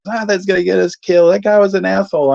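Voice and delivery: mom brushing it off. Retold or imitated. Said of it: "she goes".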